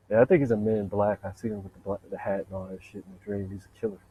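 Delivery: normal talking voice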